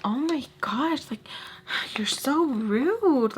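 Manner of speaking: high, drawn-out mimicry of an offended woman; mock offended whine. Vlogger imitating the woman she finds annoying, mock offended. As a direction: stretch it out in a put-on, affronted voice. Acted retort of the other woman.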